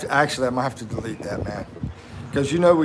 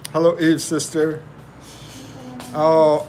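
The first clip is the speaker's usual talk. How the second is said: ordinary talk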